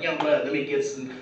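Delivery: a hint of a low, mumbling homeless guy; lightly voiced panhandler asking for change; straggler voice. Panhandler begging the comedian for change, low mumbled voice. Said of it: "didn't they give you that voice too".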